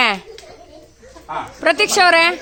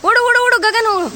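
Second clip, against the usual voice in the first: cheering on; 7 semitones higher than usual. Real, not acted. Cheering child on in game.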